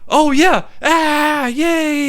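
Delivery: high, whooping cheer; imitated cheering crowd. Retelling the crowd's reaction, high mock cheering. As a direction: whoop like a whole crowd celebrating. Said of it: "literally the crowd's like".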